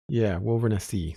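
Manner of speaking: ordinary delivery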